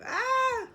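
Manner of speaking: high sustained "ah!" of exasperation; sudden high-pitched yell; frustrated yell. Real, not acted. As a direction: let out one long high cry at your own slip. Frustrated at own name slip.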